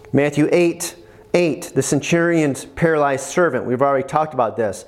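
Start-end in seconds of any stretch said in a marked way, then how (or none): none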